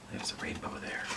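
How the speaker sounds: whispered aside. Real, not acted. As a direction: breathe it out in a secret whisper. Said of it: "whispered aside about picture".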